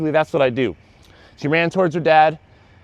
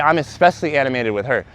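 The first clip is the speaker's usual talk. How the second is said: ordinary talk, nothing marked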